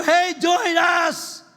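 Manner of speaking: high, drawn-out loud call; shouting. Acted. Choir ministry calls people to join, shouting. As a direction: holler it slowly to draw people in. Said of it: "shouting for you".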